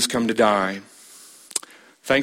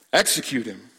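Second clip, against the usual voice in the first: usual speaking voice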